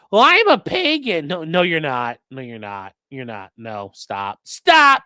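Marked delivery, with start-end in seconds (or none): 0.1-1.3 higher, louder whiny claim; mock pagan. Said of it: "that's how you deal with these people". 4.4-5.0 higher, louder sharp stop. Real, not acted. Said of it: "telling chat to stop".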